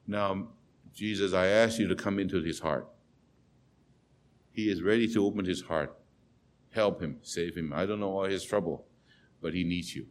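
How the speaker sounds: soft, low earnest prayer; hushed prayer. Retold or imitated. Stranger praying for the despairing doctor, soft and earnest. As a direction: pray gently under your breath.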